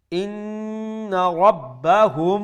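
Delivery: slow, drawn-out chant; recitation. Shown as a demonstration. Teacher reciting the verse slowly for students. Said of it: "teacher recites verse".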